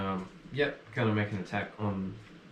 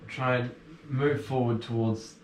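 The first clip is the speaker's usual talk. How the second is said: ordinary delivery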